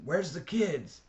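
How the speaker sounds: Johnny shouting over the roar of the fire. A narrator's reading. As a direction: yell the question over the flames. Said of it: "Johnny shouted the next question".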